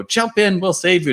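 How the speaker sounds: mock shout from a canoe to the roof; calling out.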